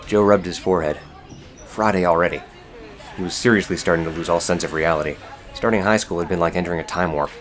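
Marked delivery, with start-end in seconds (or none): none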